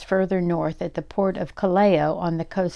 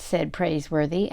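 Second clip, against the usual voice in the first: ordinary delivery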